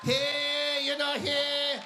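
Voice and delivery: put-on drawn-out, halting boxer with a thick Filipino accent. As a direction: stall on long fillers, heavily Filipino-accented. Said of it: "the thickest Filipino accent ever".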